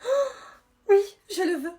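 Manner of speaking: high, loud gasp into emotional yes; overwhelmed acceptance. Acted. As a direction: gasp as if proposed to, hammed-up. Mock acceptance of a marriage proposal.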